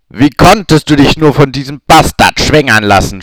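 the captor scorns the pregnant Hermine; hateful contempt. Acted. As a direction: spit the words with hatred. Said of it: "spie der Mann voller Hass und Verachtung".

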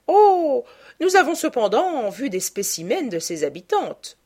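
vague duchess, starting on a high, loud "Oh"; airy reply after a high-pitched exclamation. A narrator's reading. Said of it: "répondit la duchesse d'un ton vague". (ton vague, après un « Oh » haut perché)

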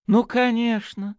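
high, slow, loud exclamation; excited cry. A narrator's reading. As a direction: cry out in sudden realisation. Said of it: "взволнованно вскричал он". (взволнованный возглас)

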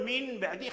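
"من بعدي" with a clear N kept before the B; faulty "min ba'di" without the change to M; wrong recitation demo. Shown as a demonstration. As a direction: sound the noon of "من" as N, not M, then "بعدي". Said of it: "خطأ، لحن".

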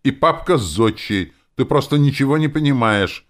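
high, loud, heated retort; exclaiming. A narrator's reading. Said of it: "воскликнула она". (с жаром восклицая)

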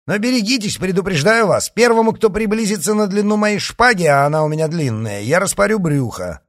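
high, loud threat; fierce warning. Acted. D'Artagnan warns riders off with his sword, fierce and loud. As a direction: rattle out a heated threat.

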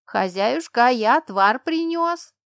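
high, loud, slow servant's call; a servant bursting in with a brew, high and loud; eager servant calling. A narrator's reading. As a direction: sing out to the mistress from the doorway. Servant calls out cheerfully.

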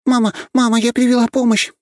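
high, loud joyful calling; little girl bursting in with news; excited child shout. Acted. Girl calls her sick mother.

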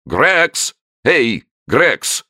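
high, loud call across the hall; shouting a name. A narrator's reading. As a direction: yell for the keeper. Director calls keeper.

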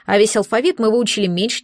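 ordinary delivery, nothing marked

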